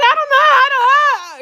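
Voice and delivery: high, loud laughing wail; panicked wail. Retold or imitated. Retelling her panic in the car, high laughing wail. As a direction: squeal it, half laughing.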